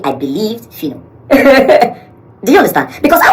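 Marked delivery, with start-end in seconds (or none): 1.3-2.0 a loud, high burst of laughter. Real, not acted. Narrator laughing at what she found out, loud laugh. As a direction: laugh out at the realization.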